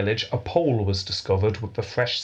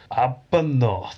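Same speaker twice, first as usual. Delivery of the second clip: slow, drawn-out Scottish Arbuthnot; Scottish accent; 3 semitones higher, slower than usual. Shown as a demonstration. Showing cohost how the name sounds, slow Scottish accent. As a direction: lean into a Scotsman saying Arbuthnot. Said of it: "you need to say it in a Scottish accent".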